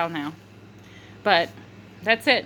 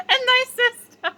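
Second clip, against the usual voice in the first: words collapsing into high giggles; uncontrolled laughing; 9 semitones higher than usual. Real, not acted. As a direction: crack up mid-sentence, voice squeaking. Laughing fit while recalling.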